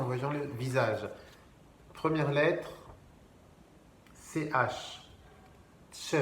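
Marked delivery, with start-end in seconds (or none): 5.9-6.2 "Tch" with a T before the sh. Shown as a demonstration. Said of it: "C'est comme un T C H". (« tch », comme un T C H)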